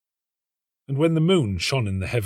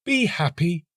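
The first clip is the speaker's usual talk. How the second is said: much higher joyful bird call; nightingale crying out; 8 semitones higher than usual. A narrator's reading. Nightingale calling to the weeping student, high and bright. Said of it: "cried the nightingale".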